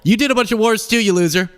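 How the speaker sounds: mock insult hurled at an ex-president; mock taunt. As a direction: needle him like a schoolyard bully. Taunting Bush directly.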